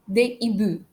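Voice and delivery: des hiboux said "day ee-boo"; silent S and X. Shown as a demonstration. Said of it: "I don't pronounce the letter S".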